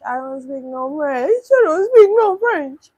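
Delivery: rising yell. Real, not acted. Escalating protest at French message.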